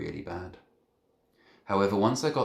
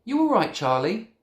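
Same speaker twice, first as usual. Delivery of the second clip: worried; 9 dB louder than usual. Acted. Boy checks on dazed friend.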